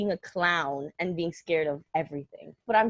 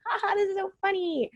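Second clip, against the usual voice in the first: mocking dad; 13 semitones higher than usual. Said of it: "He's like".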